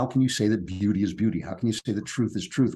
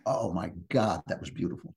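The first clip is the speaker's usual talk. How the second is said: much softer, an awed near-breath on "beautiful"; hushed awe; 4 semitones lower, 7 dB quieter than usual. Retold or imitated. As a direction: let it fall away in wonder. Retold awe after seeing Lear.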